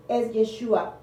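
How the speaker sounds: ordinary talk, nothing marked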